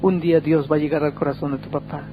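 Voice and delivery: soft, soothing mother's words; hushed, tender consolation; gentle reassurance. Retold or imitated. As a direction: comfort a distressed son quietly, taking your time. Mother comforts her crying son.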